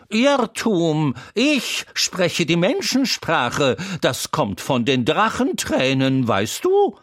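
put-on a chatty horse teasing the crying boy; Troubadour the talking horse. A narrator's reading. Horse Troubadour comforting the crying boy Wendel, high and loud.

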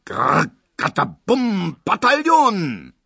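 drawn-out high booming noise, loud; loud, stretched-out mimicked thunder; imitated rolling crash. A narrator's reading. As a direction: roll it out slowly like skittles crashing. Narrator imitates skittle alley din.

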